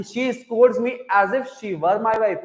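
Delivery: slow, deliberate reading of the example; slow, deliberate classroom dictation; slow model sentence. Shown as a demonstration. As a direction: read "as if she were my wife" out slowly for the class. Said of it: "अब बात समझ में आई?".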